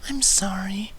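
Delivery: high, slow, gentle coo fading to a whisper; soft cooing. Acted. Fluttershy comforting the cold narrator, soft high coo. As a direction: soothe a shivering friend tenderly. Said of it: "Fluttershy cooed softly".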